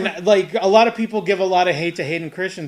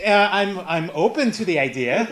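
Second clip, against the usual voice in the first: coy Ewan McGregor; 5 semitones higher, 6 dB louder than usual. Retold or imitated. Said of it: "And he's like".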